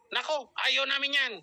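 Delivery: imitating an irritated boyfriend; mock angry. Boyfriend replies to an invitation, mock angry. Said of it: "galit na galit".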